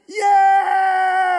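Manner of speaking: big victorious Rocky shout; triumphant yell. Retold or imitated. Pastor retelling finding the ring, triumphant yell. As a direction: roar it out like a champion rising from the water.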